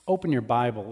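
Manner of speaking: ordinary talk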